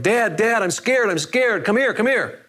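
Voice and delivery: put-on high, pleading little boy calling his dad; a frightened kid calling for Dad, slow on "scared"; little boy in a thunderstorm calls his dad, frightened; scared child crying out. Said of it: "he cries out to his dad".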